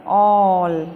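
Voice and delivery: "ऑल" with a lengthened "aw", low falling tone; long open O. Shown as a demonstration. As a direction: stretch the open vowel in "ऑल". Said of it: "ये थोड़ा लंबा चलेगा". ("ऑल" में लंबा खिंचा ऑ)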